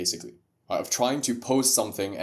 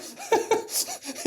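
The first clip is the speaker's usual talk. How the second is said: high laugh. Real, not acted. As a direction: burst of high-pitched laughter. Big laugh after rant.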